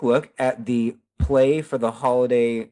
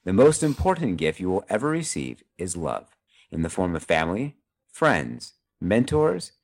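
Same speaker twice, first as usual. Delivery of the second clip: solemn closing speech; 3 semitones lower than usual. Acted. Host reads a heartfelt holiday message to listeners, lightly announcer style. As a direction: deliver it like a sentimental radio sign-off.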